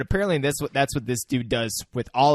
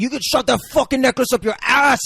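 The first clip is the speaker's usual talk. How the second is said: high, loud mock-furious yell; angry retort; 12 semitones higher, 9 dB louder than usual. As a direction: explode in hammed-up rage. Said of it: "And he's fucking angry".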